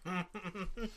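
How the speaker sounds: soft, high chuckle; soft laugh. Real, not acted. Breaking off a story about dad, soft laugh.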